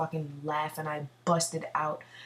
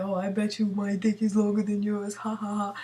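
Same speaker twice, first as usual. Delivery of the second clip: lightly voiced drunk guy, a touch higher, with a mock laugh; a hint of a boastful drunk, mock-laughing; drunk guy bragging; 4 semitones higher than usual. Drunk guy boasting to his friend, lightly voiced, mocking. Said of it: "he's like".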